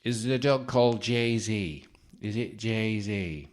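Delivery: slow, off-target mock accent, not Liverpool; a failed try at a Liverpool accent; a botched Liverpool accent, slow and put on; wrong accent for the psychic. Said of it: "No, that's the wrong accent".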